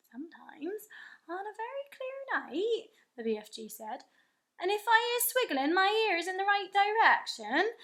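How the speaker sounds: the giant boasting of his hearing with wonder; friendly giant voice. A narrator's reading. The BFG telling Sophie what he hears, in a giant's voice.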